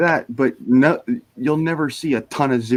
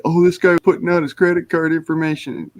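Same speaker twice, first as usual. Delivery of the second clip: higher-pitched mocking quote of gleeful trolls; mock online troll; 7 semitones higher, 3 dB louder than usual. Streamer imitates trolls spotting leaked card details, mockingly excited. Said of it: "they'll all go / Like".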